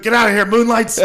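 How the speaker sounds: high, loud hammed-up shout; mock yell. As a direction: playfully yell them out of the place. Imagined drunk throwing wine, yelling.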